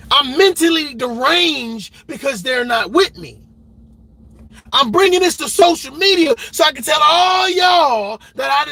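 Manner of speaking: hammed-up parody of a bitter ex; mocking whiny parent. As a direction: sneer at the oversharing parent. Mocking a parent posting online.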